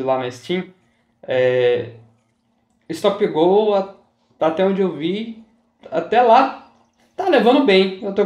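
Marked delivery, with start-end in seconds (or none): none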